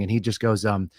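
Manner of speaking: ordinary talk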